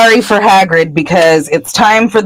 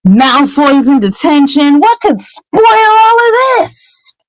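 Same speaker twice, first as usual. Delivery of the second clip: slow, rising, higher and higher smug glee; gloat, sure that nothing can go wrong; triumphant, drawn-out and pitched way up; gleeful triumph; 8 semitones higher, slower than usual. Retold or imitated. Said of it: "They're like".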